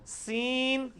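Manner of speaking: سین with a light, thin S, held high. Shown as a demonstration. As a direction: model سین as the unthickened sound. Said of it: "سین کی آواز کو موٹا کیا".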